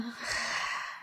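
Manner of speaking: long, soft breathy sigh; exasperated sigh. Acted. Teacher acting fed up with people bothering her, exasperated sigh.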